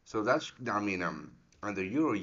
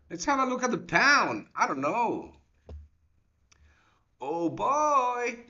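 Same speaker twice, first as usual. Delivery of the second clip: much higher and louder, a startled rise; excited outburst; 14 semitones higher, 7 dB louder, slower than usual. Real, not acted. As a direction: jump up in pitch as the chart surprises you. Sudden dramatic market check.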